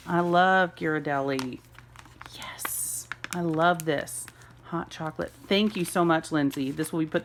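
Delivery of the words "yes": whispered yes of delight; real delighted whisper; excited whisper. Real, not acted. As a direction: hush it out like a secret joy. Whispered joy over gift.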